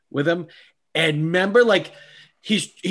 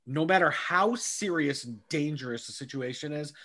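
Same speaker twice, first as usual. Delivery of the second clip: normal talking voice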